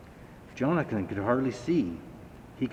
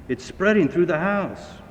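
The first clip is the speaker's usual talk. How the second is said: much higher, louder alarmed call; shouting parents; 7 semitones higher, 4 dB louder than usual. A narrator's reading. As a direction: parents calling to the firemen. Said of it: "shouted their father and mother".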